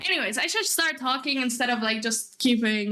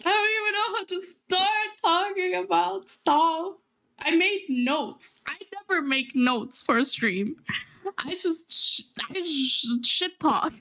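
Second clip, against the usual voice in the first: higher, tearful voice; close to crying; 6 semitones higher than usual. Real, not acted. Streamer unsure how to start talking, close to crying. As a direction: fight back tears while trying to explain. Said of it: "I feel like crying".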